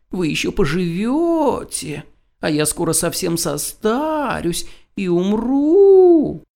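high whimpering lament, slowing down; whining. Acted. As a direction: whine it, building to a wail. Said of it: "захныкал кот".

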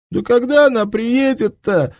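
high, loud wail close to sobbing; sobbing cry. Acted. Panicked soldier wails about the armour, sobbing cry. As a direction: cry it out in despair, near tears. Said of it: "рыдающе закричал Дуська".

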